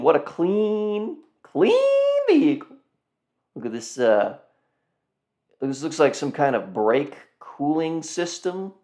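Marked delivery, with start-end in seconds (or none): 0.0-2.8 high and slow, savoring each word; high admiring gush. Real, not acted. Vlogger admiring a spotless car, high slow gush. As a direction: coo over the car like it's a treasure.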